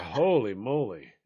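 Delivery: slow, low mock amazement; low, stretched-out retold exclamation; talking to himself. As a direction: imitate a man marvelling to himself, drawn out. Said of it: "talk to themselves".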